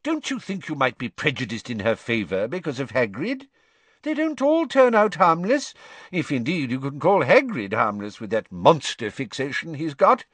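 a fussy minister dismissing concerns; pompous minister. A narrator's reading. Fudge's voice.

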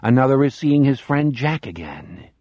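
plain reading voice

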